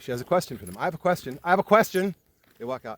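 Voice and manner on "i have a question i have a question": retold call, rising louder and higher; imitating someone trying to be heard; calling for attention. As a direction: call out, then louder and more insistent. Retelling sister calling for attention.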